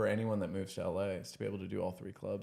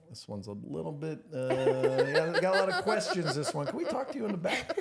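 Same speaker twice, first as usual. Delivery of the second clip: bouncer voice; 5 semitones higher than usual. Retold or imitated. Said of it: "And they go".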